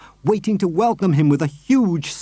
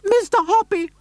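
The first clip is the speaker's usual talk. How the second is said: much higher, shrill calling out; a high lady shouting up from below; excited high-voiced neighbour calling. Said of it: "she was shouting".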